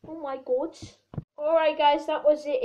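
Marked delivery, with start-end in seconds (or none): none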